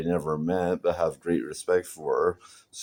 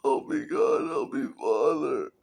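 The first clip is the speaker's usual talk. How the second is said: mock plea to God; 9 semitones higher than usual. Mock prayer after wailing.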